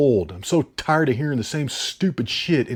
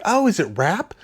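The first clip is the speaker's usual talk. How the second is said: high-pitched girly question, imitated; mock a girl asking in a raised pitch; 6 semitones higher than usual. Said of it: "And she's like".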